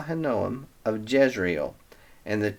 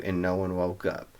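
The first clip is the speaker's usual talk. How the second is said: normal talking voice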